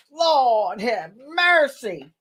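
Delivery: high, stretched Lord have mercy; slow drawn-out awe. Pretend. Awed by the penny, slow stretched exclamation. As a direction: marvel at it like a preacher.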